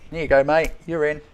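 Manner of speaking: loud, cheery welcome at the gate; jolly gatekeeper. Retold or imitated. Gatekeeper at the pearly gates waving a soul in, loud and cheery. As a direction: wave the soul through like a friendly bouncer.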